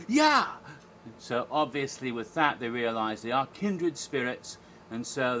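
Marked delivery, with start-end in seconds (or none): 0.1-0.5 high, loud burst of agreement; triumphant acted shout. Retold Bill's triumphant answer.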